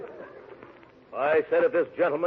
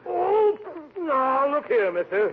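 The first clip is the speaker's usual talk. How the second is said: sudden high, loud outraged cry; indignant yelp; 10 semitones higher, 4 dB louder, much slower than usual. Acted. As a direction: jump up in pitch, affronted. Indignant outburst at insult.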